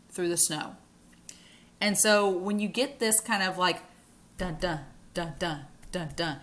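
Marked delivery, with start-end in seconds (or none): 4.4-6.4 soft "dun, dun" in paired beats; low-key rhythmic thuds, two at a time; heartbeat imitation. Shown as a demonstration. Teacher mimics a heartbeat for the class, soft paired beats. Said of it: "it not only mimics a heartbeat sound".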